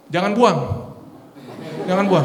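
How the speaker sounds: loud, high call to the crowd; coaching command. Shown as a demonstration. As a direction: urge them to hold their breath. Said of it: "hirup napas sekarang". (seruan keras kepada jemaat)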